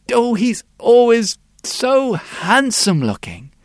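put-on high, slow child's voice; proud little girl boasting; bright little girl. The girl describes her daddy to the sculptor, high and slow.

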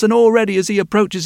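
usual speaking voice

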